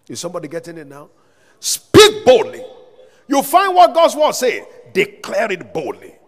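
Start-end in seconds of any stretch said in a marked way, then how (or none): 1.6-6.1 real full-force preaching shout, pitched high; bold exhortation. Real, not acted. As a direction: shout the command to stir the congregation. Preacher exhorts congregation to boldness.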